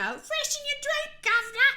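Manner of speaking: hammed-up old London gent in a British accent; mock posh British. Mocking British slang, a hammed-up British accent. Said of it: "It's like governor".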